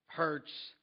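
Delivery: ordinary talk, nothing marked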